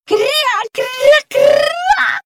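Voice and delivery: loud, very high duck quacks; quacking duck. Acted. Duck joins the angry crew, shouting quacks. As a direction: quack it out at full cry. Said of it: "закричала качечка-крячечка".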